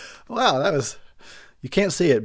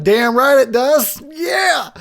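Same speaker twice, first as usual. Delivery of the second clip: cocky cheer; 12 semitones higher, 7 dB louder, much slower than usual. Retold or imitated. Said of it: "And I was like".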